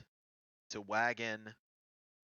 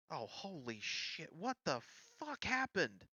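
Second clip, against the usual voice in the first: shocked outcry; 6 semitones higher, 3 dB quieter than usual. Acted. Game master voices Ember running into camp, shocked outcry. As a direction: voice leaping high on the question. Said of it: "He's like".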